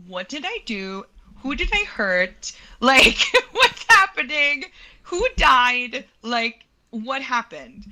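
rising, half-laughing mock panic. Summoned to the office, retelling her panicked thoughts with a laugh. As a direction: spiral into imagined disaster. Imagining disaster when summoned.

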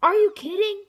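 loud, high exasperated shout; yelling at the game; frustrated shout. Real, not acted. Losing again.

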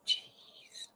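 whispered jeez on a sigh. Real, not acted. Vlogger alone at her desk, sighing a worn-out whisper. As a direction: let it out on a tired sigh.

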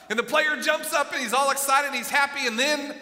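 loud and high, fired-up storytelling; loud, high, cheering crowd telling; excited. Acted. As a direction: shout it like the crowd in the stands.

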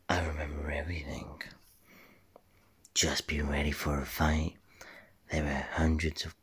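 soft, low whispered secret; hushed, conspiratorial whisper; whispered character. Acted. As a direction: whisper it like you're hiding.

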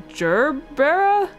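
GERBERA drawn out, rising high at the end; questioning. Real, not acted. Reader unsure of the word gerbera, drawn-out questioning rise.

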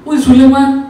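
high, loud summons; master's shrill repeated call; insistent calling out. Acted. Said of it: "ਉਹਨੂੰ ਆਵਾਜ਼ ਮਾਰਦਾ".

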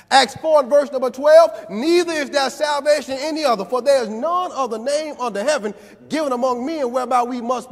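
full-force preaching. Acted. Preacher driving the point home to the congregation, full-force preaching. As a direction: whoop it up like the peak of a sermon.

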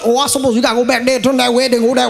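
fast, rattled-off Midwest directions; lightly imitated Midwest accent; mock Midwest directions. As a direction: rush the directions like a local. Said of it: "You ever hear people in the Midwest talk".

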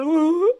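a lightly mocked sentimental hum. Mary humming sadly at Jesus, sentimental. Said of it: "మరియ బాగా sentimental".

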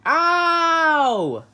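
high, loud ow with a laugh; pained yelp. Acted. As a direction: yelp in mock pain. Hurt while freeing stuck head.

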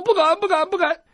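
high, loud fearful repetition; grovel, scared; cowering. Acted. Wounded doctor swearing he won't dare, high cowering voice. Captive clutching his ear.

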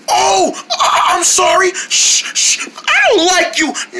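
ordinary talk

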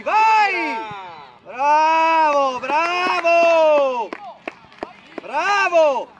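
long, drawn-out shouts of praise; excited touchline shouting; shouted encouragement. Real, not acted. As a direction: cheer the players on at full voice. Coach cheering on an attack.

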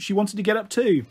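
usual speaking voice

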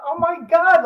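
high, loud oh my God; excited exclamation. Real, not acted. Host thrilled they played the same position, high loud outburst. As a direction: burst out in delight at the coincidence.

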